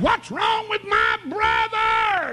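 high, slow shouted demand; big brother's shout. Retold or imitated. Big mountain boy confronting the fighter, slow shout. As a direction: come down the hall roaring at him. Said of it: "and he said".

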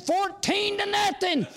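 put-on boy's cheerful hollered reply; shouting back. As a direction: yell it back from the outfield. Boy shouts score back.

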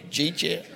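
"G-chat" run together as one word; Georgia dialect word. Shown as a demonstration. Preacher repeats his Georgia word, Georgia dialect word. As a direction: say "G-chat" softly as two slurred syllables. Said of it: "That's a word".

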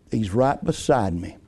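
low, soft tender quote; intimate hush. Acted. Preacher quoting the psalm to his church, soft and low. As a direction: breathe it close like a comfort. Intimate climax of sermon point.

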